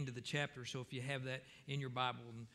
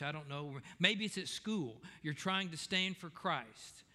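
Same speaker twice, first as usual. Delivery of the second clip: preaching; 3 semitones higher, 4 dB louder than usual. Acted. Preaching to the congregation.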